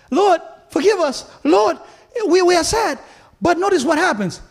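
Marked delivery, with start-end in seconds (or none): 0.1-3.0 mock crying out. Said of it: "they cried out".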